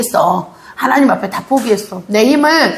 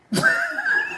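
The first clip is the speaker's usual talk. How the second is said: high laugh; 7 dB quieter than usual. Real, not acted. Preacher laughs again.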